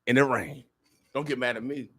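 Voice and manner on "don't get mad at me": softer, casual aside; understated aside. Real, not acted. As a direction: say it softly, almost in passing. Aside before the louder repeat.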